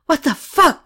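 loud, high, furious outburst; angry yell. A narrator's reading. Katie yelling at the doctor in anger. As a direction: yell it in disbelief. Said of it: "Katie yelled at him".

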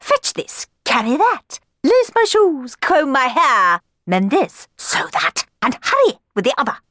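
high, loud barked orders; shrill yelling. Acted. Sisters ordering Cenerentola about, shrill yelling. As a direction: snap commands at the servant. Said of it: "their voices turned shrill as they yelled".

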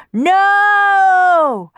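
the Furies shriek their refusal; very high, very loud cry of No; howled protest. A narrator's reading. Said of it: "they howled loudly in protest".

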